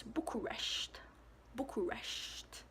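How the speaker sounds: Bucharest as boo-kuh-RESHT, quiet and slow; Romanian pronunciation. Shown as a demonstration. Vlogger giving the capital in Romanian, Romanian pronunciation. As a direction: say Bucharest the Romanian way, ending in sht. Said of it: "But in Romanian".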